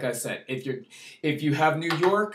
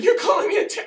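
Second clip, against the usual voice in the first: much higher half-laughing shout; shocked disbelief; 5 dB louder, much faster than usual. Retold or imitated. Speaker reacting to being called a terrorist, shocked shout. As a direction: yelp it out in outraged disbelief. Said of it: "I was like".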